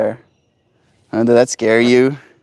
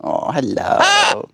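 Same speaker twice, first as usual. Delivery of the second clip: talking to a pet; much slower than usual. Acted. Greeting pet bird.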